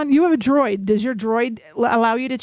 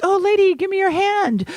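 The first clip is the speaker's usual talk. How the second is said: high, raised imitation Russian accent; 8 semitones higher than usual. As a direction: call out like a Russian kiosk seller. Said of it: "They have that sexy Russian accent".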